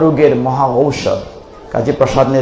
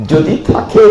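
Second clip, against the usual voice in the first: ordinary talk, nothing marked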